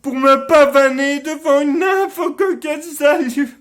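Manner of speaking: loud, high-pitched theatrical tirade; impassioned stage rant, high and forceful, easing at the very end; bitter outburst. Acted. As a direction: cry out in wounded self-loathing. Acted bitter outburst about own deformity.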